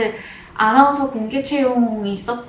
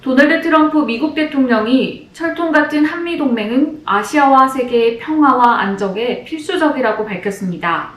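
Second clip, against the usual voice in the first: louder, measured newscaster delivery; news anchor read; 4 dB louder than usual. Shown as a demonstration. A former announcer hopeful demos her practiced news read, formal anchor style. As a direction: read it like the evening news headline. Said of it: "뉴스 연습도 진짜 많이 했어요".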